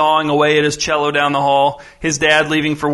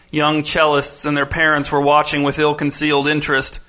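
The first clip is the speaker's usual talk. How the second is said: plain reading voice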